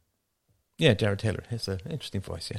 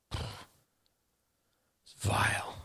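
breathy whispered disgust; 10 dB quieter, much slower than usual. Real, not acted. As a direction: hiss it under your breath. Whispered aside.

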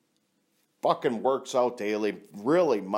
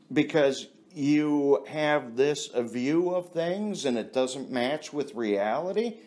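ordinary delivery

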